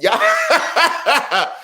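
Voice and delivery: high burst of laughter into the word; laughing. Real, not acted. Reacting to a viewer's joke about goalies, laughing. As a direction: crack up while addressing everyone.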